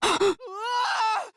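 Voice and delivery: sharp scared gasp into a high cry; gasp, then cry out in fright; frightened gasp and yelp. Acted.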